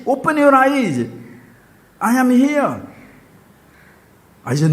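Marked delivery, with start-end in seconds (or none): 0.0-2.8 put-on higher, louder child ordering; child ordering grandpa to look; bossy child. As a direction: command it like an exasperated little boy. Voicing the small child.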